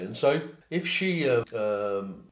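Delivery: normal talking voice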